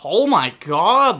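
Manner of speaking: loud, drawn-out exasperated oh my god; frustrated groan. Real, not acted. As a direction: vent real annoyance, slowly. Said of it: "I'm actually getting so mad".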